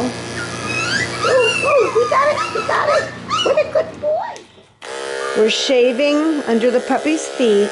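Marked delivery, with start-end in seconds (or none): 0.0-4.3 very high, excited cooing; pet-talk praise. Real, not acted. Groomer praising a puppy once it's done, high cooing.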